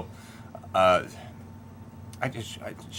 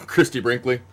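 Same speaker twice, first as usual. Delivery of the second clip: usual speaking voice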